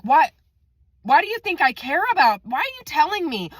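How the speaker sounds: high-pitched, incredulous questioning; retold exasperation. Cutting off coworker's steak story, high exasperation.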